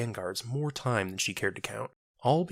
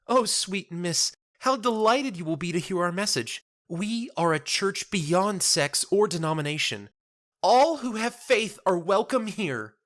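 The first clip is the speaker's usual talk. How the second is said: much higher, louder, slow, beaming preacher-like lilt; delighted churchwoman; 11 semitones higher, 5 dB louder than usual. Acted. Marifold proudly telling Jenna about her church, delighted. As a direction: gush with joy about the message.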